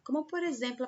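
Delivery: usual speaking voice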